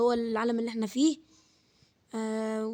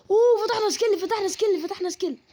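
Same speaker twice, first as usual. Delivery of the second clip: high, loud "we unlocked a skill"; excited cheer; 8 semitones higher, 4 dB louder than usual. Real, not acted. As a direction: celebrate the unlock, fading down.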